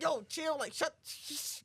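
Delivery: high urgent hush; acted shushing. [name] tells Roland to chill, high urgent shushing. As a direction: break off into a drawn shh.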